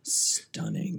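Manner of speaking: breathy whispered "Stunning" in awe. Real, not acted. Cohost gushing about Lupita's looks, whispered. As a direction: whisper "Stunning" like a gasp of admiration.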